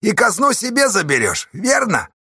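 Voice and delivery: high, jeering shout; mocking. A narrator's reading. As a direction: needle him with a loud leading question. Mocking questions go on.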